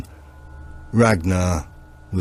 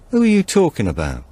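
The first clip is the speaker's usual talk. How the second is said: Tommy's sharp question; 9 semitones higher, 3 dB louder, faster than usual. A narrator's reading. Tommy suddenly turns to him.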